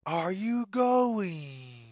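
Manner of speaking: ordinary delivery, nothing marked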